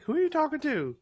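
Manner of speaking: voiced dog. Dog owner voicing her dog.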